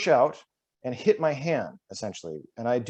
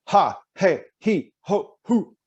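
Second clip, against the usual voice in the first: loud high bursts thrown across the room; projected pulses; 7 semitones higher, 5 dB louder, slower than usual. Shown as a demonstration. Coach projecting to the far side of the room, loud. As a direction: push each one to the back wall. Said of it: "get those ha and he and he and ho all the way to the other side of the room".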